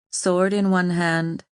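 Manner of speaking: ordinary delivery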